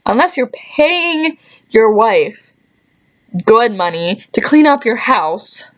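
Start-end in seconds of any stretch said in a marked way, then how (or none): none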